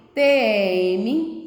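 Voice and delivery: T E M spelled letter by letter, slowly; spelling out letters. Shown as a demonstration. Teacher spells T E M slowly for young pupils.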